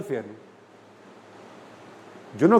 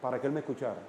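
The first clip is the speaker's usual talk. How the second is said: much softer, low murmur; 6 semitones lower, 14 dB quieter, much faster than usual. Retold or imitated. Father reading the Bible softly to his son, hushed. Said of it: "se lo leí en voz baja".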